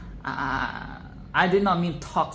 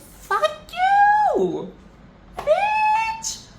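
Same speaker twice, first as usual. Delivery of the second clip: a much higher, louder outraged shriek; yelling; 6 dB louder, much slower than usual. Real, not acted. Yelling at Queen to Be on screen, loud outraged shriek.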